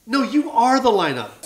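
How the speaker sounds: loud, high, forceful protest; emphatic insistence. Retold or imitated. Comic tells star the lineup doesn't matter, emphatic insistence. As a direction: insist as if it's obvious.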